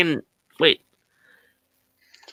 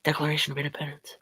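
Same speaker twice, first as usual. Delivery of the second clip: Nicolas Cage impression; 4 semitones lower, 8 dB quieter than usual. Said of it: "Nicolas Cage".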